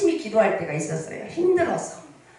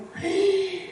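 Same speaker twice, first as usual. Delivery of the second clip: gasp; 9 semitones higher, 3 dB quieter than usual. Retold or imitated. Friend reacts to opening news.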